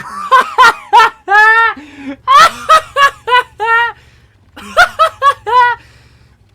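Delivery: loud, high shrieking laugh; uncontrolled high-pitched laughing fit; excited laughter. Real, not acted. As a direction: burst out in a long, shouted cackle. Erupts at the ram kill.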